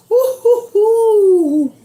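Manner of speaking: high, loud whooping; excited whoop. Real, not acted. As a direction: whoop with delight. Reacts to peach cobbler.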